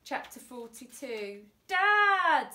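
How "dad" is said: put-on loud high cry of a boy; desperate shout. A narrator's reading. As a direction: yell after your father as he is taken away. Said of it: "shouted Frank".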